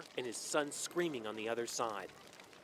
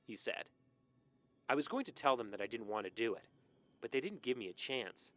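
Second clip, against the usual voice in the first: ordinary delivery, nothing marked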